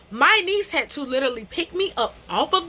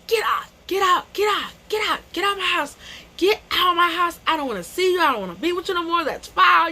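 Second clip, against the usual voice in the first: retold yelling; 5 semitones higher, faster than usual. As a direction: throw him out, furious. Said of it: "you know, going off".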